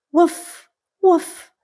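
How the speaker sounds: high yappy little puppy woofs; puppy bark. Acted. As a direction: bark like a tiny excited puppy. Puppy barks in story.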